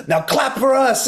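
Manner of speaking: high, slow demand for applause; frontman crowd call. Acted. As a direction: command the crowd like a rock singer. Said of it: "and then he would go".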